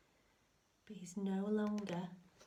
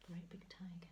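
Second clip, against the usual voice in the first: whisper; 9 dB quieter than usual. Real, not acted. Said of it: "whispered aside".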